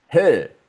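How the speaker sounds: "he" as German hö, rounded lips; H with O umlaut. Shown as a demonstration. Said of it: "La H con O umlaut".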